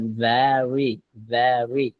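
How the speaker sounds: very with a heavy, rolled final r; thick final r. Shown as a demonstration. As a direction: say very with a thick r at the end. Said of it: "রটা একটু মোটা করে দিবেন". (very শব্দের শেষে র মোটা করে)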